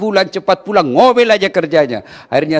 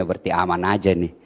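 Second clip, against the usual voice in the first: much lower, softer scheming murmur; inner thought; 10 semitones lower, 7 dB quieter than usual. Retold or imitated. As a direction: think it aloud under your breath. Dalam hati suaminya. (suami berbisik dalam hati, pelan dan rendah)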